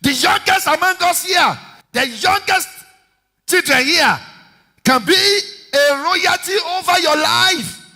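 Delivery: high, loud, ringing preaching; booming pulpit declaration; full-force preaching. Acted. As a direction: proclaim it over the music.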